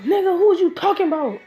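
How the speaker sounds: high, loud indignant demand. Acted. Naruto and Mema to Kiba, indignant and loud. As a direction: confront the friend hotly. Offended at maids remark.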